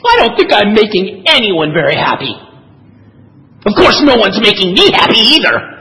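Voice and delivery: mildly scowling cartoon complaint; lightly acted grievance with a scowl; Lucy. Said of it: "a scowl darkens her brow".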